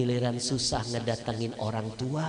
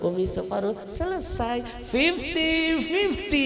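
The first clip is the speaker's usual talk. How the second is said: playful mother; 3 dB louder than usual. Acted. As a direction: stretch it out teasingly. Mother playfully splits envelope.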